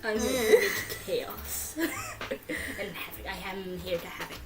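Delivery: lightly voiced comic cat hero; Imitation Cat voice. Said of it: "how Imitation Cat speaks".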